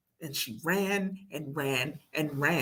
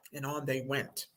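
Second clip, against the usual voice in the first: ordinary delivery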